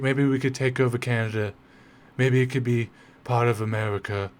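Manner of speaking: a lightly done, badly faked blustering American politician voice; half-hearted mock bluster, tongue in cheek; terrible Trump impression. Lecturer mocking the president's Greenland talk with a terrible impression. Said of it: "I'm doing a terrible Trump accent".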